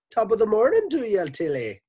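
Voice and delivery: high, fast, loud Scottish greeting; Scottish villager. A narrator's reading. Man greets wife after long silence, high Scottish burst. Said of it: "a small village in Scotland".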